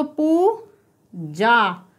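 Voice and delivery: Puja with a drawn-out पू, then जा; long vowel sound. Shown as a demonstration. Teacher pointing out the long sound, Puja said stretched. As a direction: lengthen both vowels of Puja. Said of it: "long sound आ रहा है".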